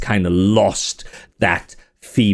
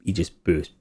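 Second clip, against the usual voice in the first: normal talking voice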